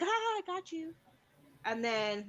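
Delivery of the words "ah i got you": put-on high playful gotcha in a child's voice. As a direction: pounce on the hiding cat. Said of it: "Odessa will stick her hand in and like".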